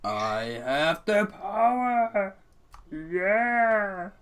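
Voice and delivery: high, very slow heroic cry; He-Man shout. Acted. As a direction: hold it up like a cartoon hero. He-Man impression.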